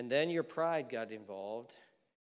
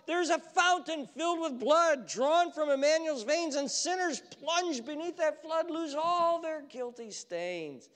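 loud, high pleading call; God yelling; 13 semitones higher, 10 dB louder, slower than usual. Acted. God yells at wandering believers to come back, loud and high. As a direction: call the strays back at full voice. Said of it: "come down and just yell at us".

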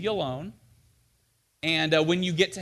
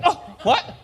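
confused campers; 11 semitones higher, 7 dB louder than usual. Retold or imitated.

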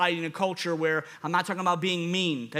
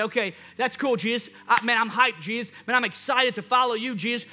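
hyped doubtful follower; 3 semitones higher than usual. Retold or imitated. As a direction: play the excited follower who keeps asking Jesus about hours and pay. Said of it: "this is probably what we would do".

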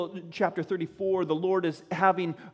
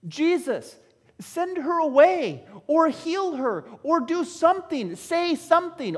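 exasperated pleading; 5 semitones higher, 3 dB louder than usual. Acted. Disciples urging Jesus about the woman, exasperated and building. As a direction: plead with mounting impatience.

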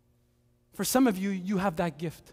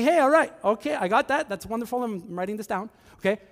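mock cheery phone voice; 3 semitones higher than usual. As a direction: play the forced cheerful listener on a call. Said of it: "You're like".

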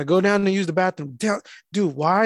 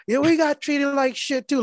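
imitating a drunk heckler, pitched up; higher-pitched retold heckle; mock drunken heckling; 7 semitones higher than usual. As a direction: heckle like a drunk woman, lightly played. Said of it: "kinda heckling".

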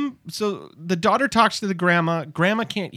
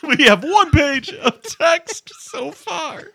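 high, slow, laughing announcement; mock-triumphant high voice, drawn out; laughing writer; 9 semitones higher, 4 dB louder, slower than usual. Acted writers' room, laughing.

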